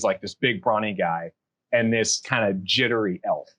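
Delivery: ordinary talk, nothing marked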